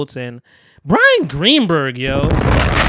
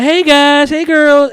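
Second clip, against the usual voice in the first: cheery greeting; 10 semitones higher, 7 dB louder, much slower than usual. Retold or imitated. Elaine greets the girls.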